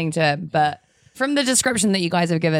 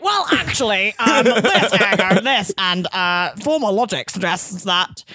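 smug logic pedant; slower than usual. Retold or imitated. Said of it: "it's like".

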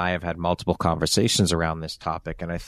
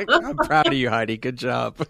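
much higher, loud laughing praise; laughing tease; 5 semitones higher, 3 dB louder than usual. Real, not acted. Host teasing Heidi about her swearing, laughing high and loud. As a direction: praise her while cracking up.

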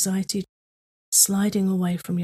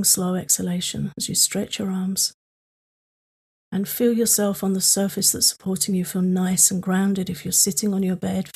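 calm grounding exercise. Acted.

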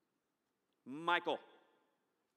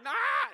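high loud shriek; toddler shriek; 8 dB louder than usual. Retold or imitated. Preacher imitating his son's shriek, high toddler shriek. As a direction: squeal like a toddler.

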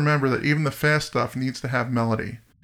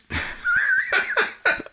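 high laugh. Real, not acted. Laughs after his own accent joke.

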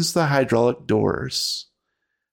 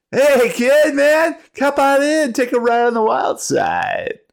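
stoner bus driver voice; 5 dB louder than usual. Acted. Bus driver greets kids, stoner voice.

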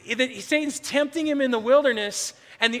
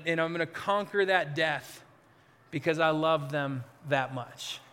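tender resolve; 5 semitones lower than usual. Acted. Jesus voiced, resolute love.

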